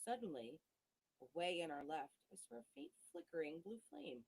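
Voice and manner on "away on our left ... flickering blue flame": usual speaking voice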